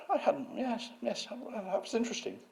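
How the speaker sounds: high, faltering mock concession; David Wilson. Museum head conceding the point, imitated high and halting. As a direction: imitate a sneerer reluctantly giving way, pitched far up. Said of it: "he is a sneerer".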